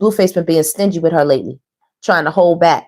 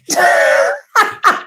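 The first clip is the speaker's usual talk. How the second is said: scream-laugh; shrieking laugh; 12 semitones higher than usual. Real, not acted. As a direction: howl with laughter up high. Loud laugh at "I'm the mama.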